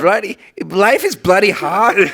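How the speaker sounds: overdone British accent, drawn out; too British. Shown as a demonstration. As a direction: stretch it out in British tones. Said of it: "that's fucking too British".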